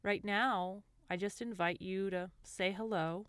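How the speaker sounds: gentle guided meditation voice, lingering between phrases. Acted. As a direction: ease the listener into calm, unhurried.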